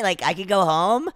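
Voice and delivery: ordinary delivery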